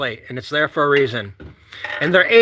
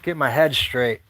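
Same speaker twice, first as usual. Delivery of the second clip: ordinary delivery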